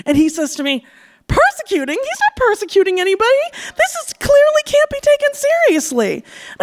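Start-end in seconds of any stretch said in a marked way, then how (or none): 1.3-3.5 higher, scoffing repeat of her word; mocking disbelief. Date mocks her word. 3.8-6.1 very high, put-on scoffing voice; dismissive date voice.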